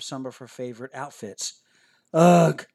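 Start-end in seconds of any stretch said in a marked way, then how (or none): 2.1-2.7 loud, frustrated ugh growled out. Acted. Girl outgrowing her favorite clothes, growling ugh. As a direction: growl it out in disgust. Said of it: "she growled".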